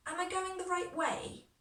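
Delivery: "Am I going the right way" pitched high, unsure. Shown as a demonstration. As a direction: send "Am I going the right way" up, doubtful. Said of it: "my voice goes up? 'Cause I'm not sure".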